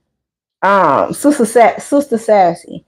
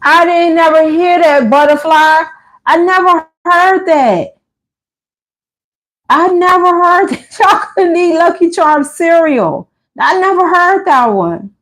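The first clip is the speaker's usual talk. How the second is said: amused disbelief; 7 semitones higher than usual. Real, not acted. Reacting to live chat comment.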